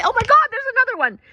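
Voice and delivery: high, loud thrilled exclamation; real excitement, voice leaping up; excited. Real, not acted. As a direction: burst out at the second bird. Another condor appears, thrilled.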